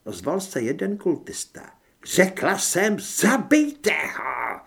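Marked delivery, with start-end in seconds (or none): 2.0-4.6 high, drawn-out furious yell; enraged werewolf woman's slow shriek; shrieked command. A narrator's reading. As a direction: scream the order, stretching the last words. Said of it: "zaječela vlkodlačice".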